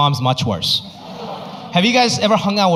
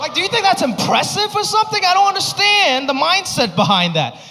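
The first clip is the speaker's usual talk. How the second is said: exasperated retort; 12 semitones higher than usual. Retold or imitated. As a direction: push back in exasperation. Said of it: "I was like".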